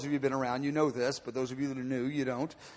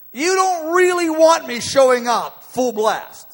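God's warning; 10 semitones higher, 9 dB louder, slower than usual. Acted. God warns Moses in sermon.

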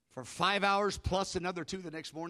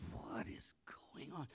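whisper; 2 semitones lower, 16 dB quieter, slower than usual. Retold or imitated. Awed whisper in night prayer.

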